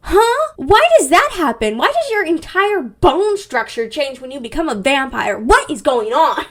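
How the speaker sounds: loud, high-pitched incredulous questions; exasperated bafflement. Real, not acted. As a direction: demand an explanation, punching the words. Host baffled by vampire changes.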